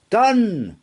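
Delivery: high ringing cry; unicorn's bright call. Acted. Unicorn granting the horse's wish, a high bright cry.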